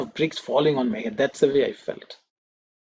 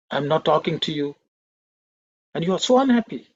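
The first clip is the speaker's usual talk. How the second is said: louder, reproachful questioning; stern scolding; 3 dB louder than usual. Retold or imitated. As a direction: scold a sulking student, retold. Swami scolds the unhappy student.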